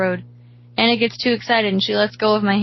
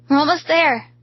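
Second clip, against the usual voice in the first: higher, louder, hopeful call; eager encouragement; 7 semitones higher, 5 dB louder than usual. A narrator's reading. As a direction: urge them on, pitched up. Friend encourages as they near help.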